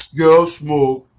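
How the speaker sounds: low-pitched fake cool; acting cool. Acts cool under peer pressure.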